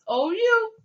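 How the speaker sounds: teacher stresses the letters O U for the class; emphatic letters. Shown as a demonstration. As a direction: hit the letters O U hard and high.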